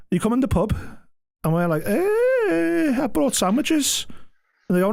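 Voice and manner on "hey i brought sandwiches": high, slow and innocent; naive newbie. Retold or imitated. New hire telling the pub crowd, high innocent voice. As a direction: play the eager new guy.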